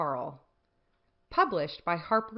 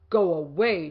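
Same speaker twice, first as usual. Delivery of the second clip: grouchy shout; 7 dB louder than usual. A narrator's reading. Grouchy ladybug shouts at the friendly one, loud and cross. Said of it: "shouted the grouchy ladybug".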